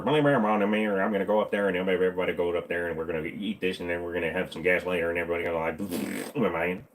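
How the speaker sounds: low, soft, run-together mumble; Boomhauser. Shown as a demonstration. Cartoon neighbor rambling about eating, low fast mumble. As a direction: rattle it off low with words sliding together. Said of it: "Then you wind up as Boomhauser".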